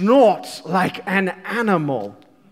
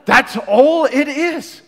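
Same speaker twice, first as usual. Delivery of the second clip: higher, louder, slow and intense; a touch of Jesus; 7 semitones higher, 8 dB louder, slower than usual. Retold or imitated. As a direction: say it as Jesus, urgent and bare. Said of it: "he's like".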